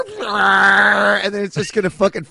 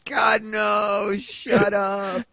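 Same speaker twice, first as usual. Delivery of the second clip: mock annoyed shout; 5 semitones higher, much slower than usual. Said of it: "I'm like".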